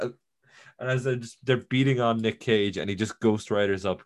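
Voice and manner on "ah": put-on frightened kid in the jail cell squealing; scared kid. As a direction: squeak it out like a terrified kid backing away. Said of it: "one kid in the back who's just like".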